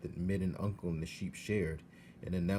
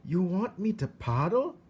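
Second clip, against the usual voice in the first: Mig's voice; 11 semitones higher, 6 dB louder than usual. Acted. Mig mishears.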